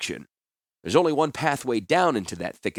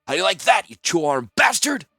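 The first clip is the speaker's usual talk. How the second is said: vicious taunt; 8 semitones higher, 6 dB louder than usual. Acted. Mock chopping at imagined enemy.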